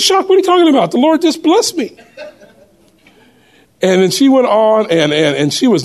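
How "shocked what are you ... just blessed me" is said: surprised protest. Retold or imitated. Retold protest to Kathy.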